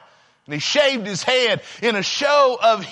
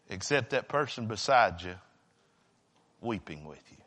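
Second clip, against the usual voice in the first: low, very soft voice with heavy pauses; hushed preaching; 7 semitones lower, 7 dB quieter than usual. Real, not acted. Preacher describing someone weeping beside you, hushed preaching. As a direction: let it sink almost to nothing, solemnly.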